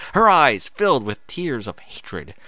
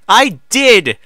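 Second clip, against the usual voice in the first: stern; 11 semitones higher, 12 dB louder than usual. Acted. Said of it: "she said sternly".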